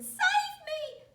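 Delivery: high, loud desperate cry; scream. A narrator's reading. Wife screaming as she flies into the sky. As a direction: shriek for rescue. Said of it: "she screamed".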